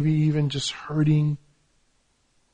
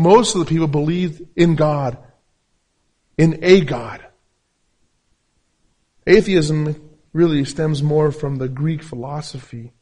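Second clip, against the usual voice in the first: ordinary talk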